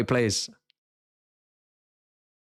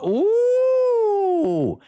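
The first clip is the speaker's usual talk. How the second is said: a high, delighted ooh at the new haircut; 6 dB louder than usual. Real, not acted. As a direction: let out an amused, high-pitched ooh.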